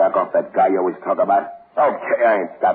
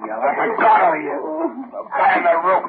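straining. Acted. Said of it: "Help me lift it".

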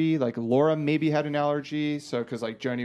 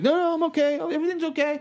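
nervous reassurance; 12 semitones higher, much faster than usual. Retold or imitated.

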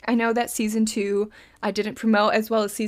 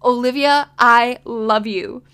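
louder self-affirmation; 8 dB louder, slower than usual. Shown as a demonstration. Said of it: "Say your name here".

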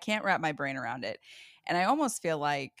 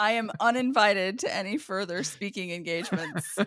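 laughing; 3 semitones higher, 4 dB louder than usual. Real, not acted. Joking she jinxes guest.

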